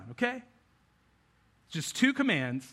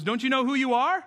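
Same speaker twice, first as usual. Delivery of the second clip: raised, challenging mentor's question; Rafiki; 6 semitones higher, 4 dB louder than usual. Retold or imitated. Rafiki challenging Simba after hitting him, lightly voiced mentor. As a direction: lift pitch high into an insistent question. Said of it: "Rafiki hits him over the head with the staff and goes".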